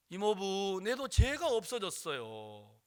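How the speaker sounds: slow, drawn-out happy announcement, rising high; overjoyed child. Retold or imitated. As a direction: share the joy like a child. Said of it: "너무 기뻐하는 거예요".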